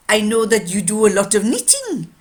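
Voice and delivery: put-on loud French-accented watchmaker. Watchmaker telling a customer she knits, French accent. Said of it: "a French watchmaker".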